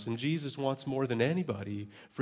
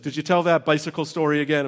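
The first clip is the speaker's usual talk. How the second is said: higher, louder teasing question; mother-in-law; 4 semitones higher, 9 dB louder than usual. Retold or imitated. Mother-in-law asking if the bicycle story came again. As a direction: tease him about the old story. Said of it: "So she's like".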